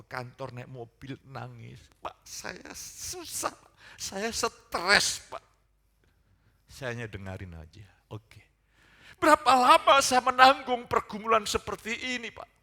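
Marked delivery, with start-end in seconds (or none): 2.0-5.4 slow, high mock weeping, swelling on the last words; imitated tearful complaint. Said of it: "dia ingat nangis". 9.2-12.5 loud, high retold lament; imitated distressed outcry. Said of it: "dia ingat nangis".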